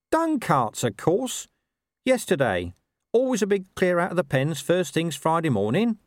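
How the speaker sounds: high-pitched, know-it-all dog; talking dog Gaspode. Acted. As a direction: answer as the expert nose, pitched high. Gaspode answers.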